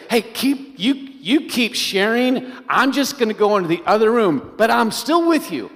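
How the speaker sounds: mock distracted reassurance, called out; calling from another room. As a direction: call back over your shoulder that you're still listening.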